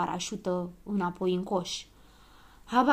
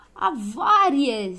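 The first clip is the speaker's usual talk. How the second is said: long, drawn-out cry; loud, stretched storybook shout; shouted alarm; 5 semitones higher, 7 dB louder, much slower than usual. A narrator's reading. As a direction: call out the emergency loudly and slowly. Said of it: "strigă Limonadă".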